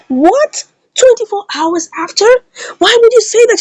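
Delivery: high-pitched acted disbelief; incredulous protest. Acts out a shocked reaction.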